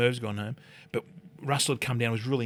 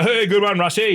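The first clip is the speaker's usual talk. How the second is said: higher, louder, cheeky congratulations; loud mock praise; 8 semitones higher, 8 dB louder than usual. Player ribbing Russell after his fake try, loud and cheeky. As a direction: hail him loudly, half taking the mick.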